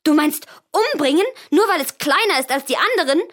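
high, loud horrified protest; a shocked girl yelling at her mother; outraged shout. Acted. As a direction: cry out against the cruelty. Girl protests killing the piglet.

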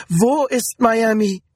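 high, slow, desperate question; frightened, high-pitched asking; panicked. A narrator's reading. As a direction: draw the question out in alarm. Panicked woman searching for land.

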